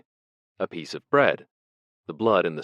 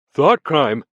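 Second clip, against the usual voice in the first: high, loud, near-sobbing admission; almost blubbering; 9 semitones higher, 12 dB louder than usual. Acted. Parsons admits his crime to Winston, almost blubbering. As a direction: nearly break down on it. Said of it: "almost blubbering".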